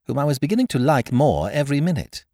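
ordinary delivery

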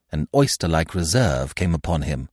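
ordinary delivery

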